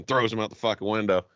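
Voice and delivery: usual speaking voice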